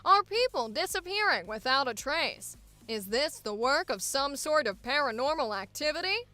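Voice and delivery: brisk transatlantic newsreel announcer; 1950s corporate safety film narrator; old-timey announcer. Acted. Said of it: "Old-time announcer or transatlantic accents".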